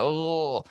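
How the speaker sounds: soft, high mock ooh of a singer; imitated singer's ooh. Shown as a demonstration. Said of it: "he's got a little bit of that".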